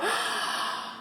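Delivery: quick high breathless gasp of wonder; breathy gasp. Real, not acted. Opening the package, gasping in wonder. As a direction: suck in air in delight.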